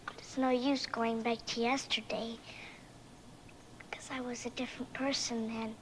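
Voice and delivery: soft unvoiced whisper. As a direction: whisper it like a secret. Alice confiding to creatures.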